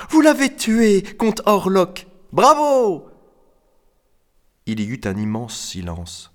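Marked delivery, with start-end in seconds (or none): 0.1-3.1 high, loud admiring cries; ladies cheering. A narrator's reading. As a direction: cheer the hero at full voice. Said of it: "crièrent les dames sur la table". (acclamations aiguës et fortes)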